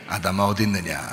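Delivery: low, soft voice of God; lightly acted solemn call, pitched low; God calling Adam. God calling to hiding Adam, low solemn role. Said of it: "그런데 하나님이".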